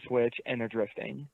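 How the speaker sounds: ordinary delivery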